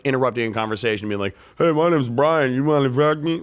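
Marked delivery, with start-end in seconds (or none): 1.6-3.4 a put-on cocky dude voice, a little louder; sleazy pickup guy. Mocking a crude guy interrupting girls, in a cocky put-on voice. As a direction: play the clueless guy barging in with a crude line.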